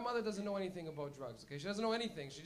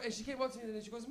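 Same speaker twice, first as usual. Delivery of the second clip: ordinary talk, nothing marked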